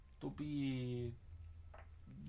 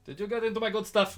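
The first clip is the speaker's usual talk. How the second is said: louder, higher accusing question; accusing companion; 5 semitones higher, 8 dB louder than usual. Acted. Smoker's mate asking about his stash, loud accusing character voice. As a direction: play the companion, pitched up and loud. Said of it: "the other one is sort of like".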